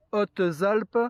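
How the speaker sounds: ordinary talk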